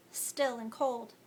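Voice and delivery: plain reading voice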